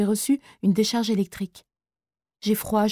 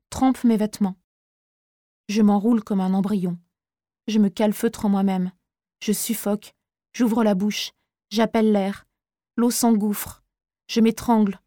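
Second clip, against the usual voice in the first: plain reading voice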